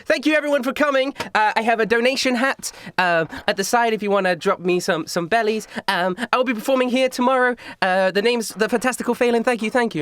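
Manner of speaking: high, quick sign-off patter; chirpy high-pitched stage patter; stage magician showman. Acted. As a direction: thank the crowd in a high showgirl voice. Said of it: "she takes a bow and she's like".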